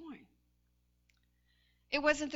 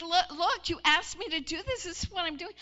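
louder, irritated retort; annoyed husband; 6 semitones higher, 8 dB louder than usual. Retold or imitated. Husband snapping back at the cranky wife. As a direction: snap back at your wife. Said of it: "He's like".

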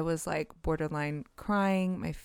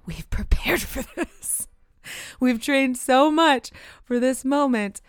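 rising, louder self pep talk, a laugh in it; mock self pep talk; 4 semitones higher, 6 dB louder than usual. Coaching herself in a dream, building louder. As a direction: talk yourself down, then build to a loud cheer.

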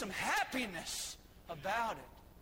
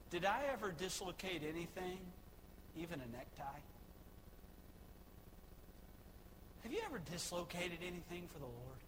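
quiet rueful aside; 3 semitones lower, 9 dB quieter, faster than usual. Real, not acted. Rueful self-question, dropping voice.

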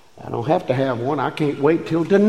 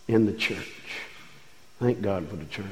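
hushed reverence; 8 semitones lower, 13 dB quieter than usual. Real, not acted. Quiet close before prayer.

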